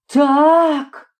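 high exclamation; crying out. A narrator's reading. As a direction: cry out the single word, voice jumping up. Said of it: "скричала".

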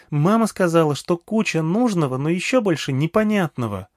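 put-on high, eager child voice; small girl eagerly addressing an old man; little girl. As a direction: pitch it up like a chatty little girl. Girl reports what mother said.

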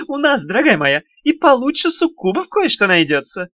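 high, giggling little imp voice; sly teasing with a snicker; giggly imp. Acted. Тухламон дразнит Даф. (хихикающий вкрадчивый голосок беса)

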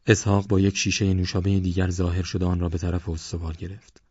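ordinary delivery, nothing marked

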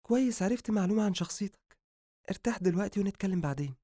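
composed high-pitched female character; calm female doctor. Acted. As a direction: settle him down in a high, light female voice. Doctor calms patient.

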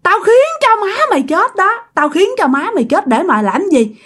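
high-pitched vengeful cursing; acted spirit's furious curse; fierce threats. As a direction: spit out hatred as a vengeful ghost. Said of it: "Làm rất là hung dữ".

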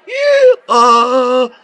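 loud braying hee-haw; donkey bray. A narrator's reading. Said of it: "выразительный ослиный рёв".

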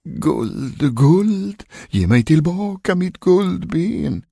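high, long-drawn wail on one note; ghostly calling voice. A narrator's reading. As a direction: wail it from beyond the grave. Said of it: "rösten ropade igen". (klagande, utdraget spökrop)

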